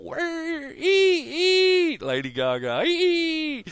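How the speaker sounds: high, spooky keening wail; eerie wail. Pretend. Mocking [name]'s soul-stealing with a high eerie wail. Mocking [name] taking souls.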